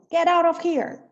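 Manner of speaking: loud, sharp and rude; angry shout. Shown as a demonstration. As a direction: bark it out furiously. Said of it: "põe um please depois".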